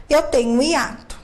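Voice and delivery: usual speaking voice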